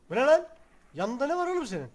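loud, high shout of surprise; surprised yell. Real, not acted. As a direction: shout at the odd sight.

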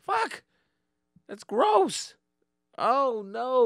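high, loud freaked-out rising panic; a sudden grossed-out panic; squealing oh no; panicked inner voice. Retold or imitated. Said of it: "I was like".

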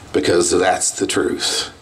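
normal talking voice

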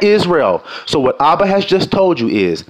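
ordinary talk, nothing marked

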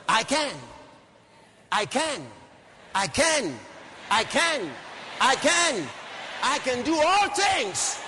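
rising, punched declarations building to a slow shout; preacher's chant-like build-up; emphatic preaching. Real, not acted. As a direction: drive each repeat harder, then stretch the last.

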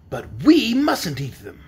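slow, emphatic caution; warning. A narrator's reading. Wizard cautioning the children, warning. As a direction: draw it out as a warning. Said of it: "the wizard warned the children".

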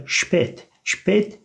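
"spät" said SHPET; careful German "spät", shpet; umlaut A as E. Shown as a demonstration. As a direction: model "spät" with sh and an e vowel. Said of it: "E şeklinde okunuyor".

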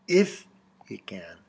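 "If" set apart, then "you can" dropping to a near whisper; doubtful. Retold or imitated. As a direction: let "you can" sink away in doubt. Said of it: "doubt is implied".

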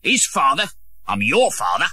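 gruff father scoffing. A narrator's reading. John Canty correcting the boy, gruff scoff. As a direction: brush off the nonsense.

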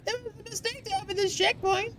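high, soft, quick mock voice; mimicking falsetto. Player parroting the game's line, high soft mimicry. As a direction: parrot it back small and high-pitched.

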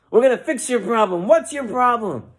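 high revival-healer call; preacher. Acted. Preacher offers to fix Sam's problem, fired up. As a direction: promise it grandly, high and ringing. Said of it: "The preacher then sees Sam".

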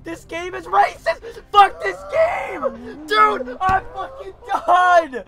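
loud, high gamer rage; raging shout. Real, not acted. Gamer falling for no reason, raging shout. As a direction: wail furiously at the unfair game.

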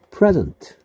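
"present" with the first syllable hit high and loud, noun form; stress on the first syllable. Shown as a demonstration. Teacher showing students the noun stress, first syllable stressed. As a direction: punch the PRE of "present", pitch jumping up on it. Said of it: "여기를 세게".

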